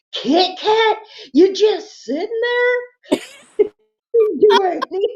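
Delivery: high, slow incredulous reproach; drawn-out disbelief at a lazy cat; scolding the cat. Retold or imitated. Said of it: "he says".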